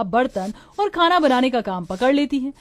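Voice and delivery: ordinary delivery, nothing marked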